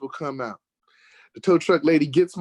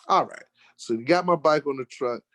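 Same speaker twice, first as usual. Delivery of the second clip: normal talking voice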